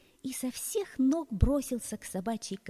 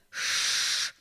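angry hiss; goose hiss; 5 dB louder than usual. Acted. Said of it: "зашипел". (шипение гуся)

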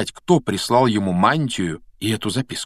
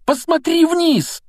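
very high, drawn-out urgent cry; excited yell; 4 dB louder, slower than usual. A narrator's reading. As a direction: yell for a friend to look, pitch soaring. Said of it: "неожиданно завопил Рон".

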